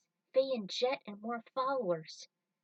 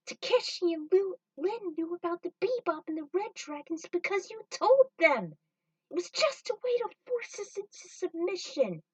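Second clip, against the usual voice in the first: much higher, loud outraged voice; angry accusation; 9 semitones higher, 6 dB louder than usual. Acted. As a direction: throw the accusation in their faces. Accusing the traitors.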